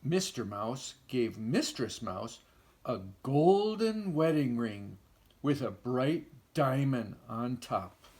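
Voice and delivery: plain reading voice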